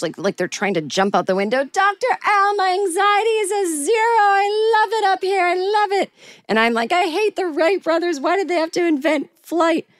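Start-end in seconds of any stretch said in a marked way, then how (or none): 1.6-6.1 very high, gushing excitement; hammed-up high-pitched delight; imitating elated classmates. Mimics elated classmates at windows. 6.9-9.9 high-pitched panicky complaint; hammed-up anxious protest; acted freaking out. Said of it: "I am freaking out".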